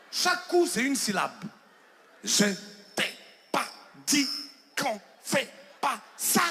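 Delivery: each syllable chopped apart, one blow per syllable; hammered syllables. Retold or imitated. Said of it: "elle me frappait par syllabes". (syllabes martelées une à une)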